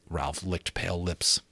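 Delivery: plain reading voice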